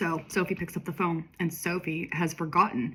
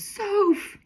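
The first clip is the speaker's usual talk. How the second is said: excited call; 4 dB louder than usual. Acted. Friend [name] spotting Sophie.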